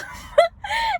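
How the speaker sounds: short high laugh mid sentence; real laugh. Real, not acted. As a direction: laugh at herself. Laughs telling the fight.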